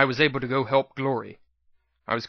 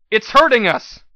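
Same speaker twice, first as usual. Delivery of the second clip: shouting; 8 semitones higher, 8 dB louder than usual. Acted. Shouting during fight.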